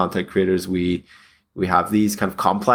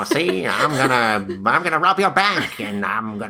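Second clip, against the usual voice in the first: thirties cartoon gangster; 5 semitones higher than usual. Host voicing his inner critic as a gangster, hammed up. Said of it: "a thirties era cartoon gangster".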